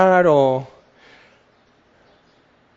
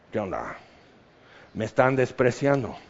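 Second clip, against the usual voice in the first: much lower, softer hurt mumble; mock offended brother; 7 semitones lower, 8 dB quieter than usual. Jesus' brother ignored by the crowd, low hurt grumble. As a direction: grumble like a snubbed sibling. Brother voiced feeling snubbed.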